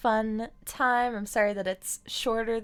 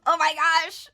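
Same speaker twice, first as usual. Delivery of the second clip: high, loud delighted exclamation; excited gasp; 9 semitones higher, 9 dB louder than usual. Real, not acted. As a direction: burst out with delight. Sees cute phone case.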